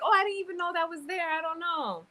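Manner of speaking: mock-innocent, high and quick; feigned innocence. Brushing off questions about her bruises, feigned innocence. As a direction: play clueless in a high, hurried voice.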